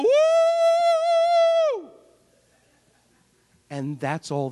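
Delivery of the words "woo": high, loud whooping war cry. Retold or imitated. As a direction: whoop it out like a soldier taunting the enemy. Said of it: "they face each other and they go".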